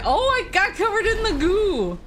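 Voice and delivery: high, laughing yelp; startled laugh mid-sentence; surprised laughing. Real, not acted. Surprised by goo in game.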